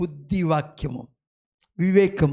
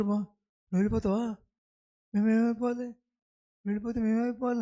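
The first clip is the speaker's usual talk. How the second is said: a hint of fretful disciples, softer; worried disciples; 4 semitones higher, 4 dB quieter, faster than usual. Disciples begging Jesus not to go, softly pleading. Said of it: "శిష్యులు అంటారు".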